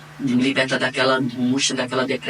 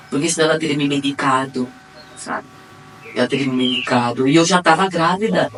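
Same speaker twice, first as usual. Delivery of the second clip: ordinary talk, nothing marked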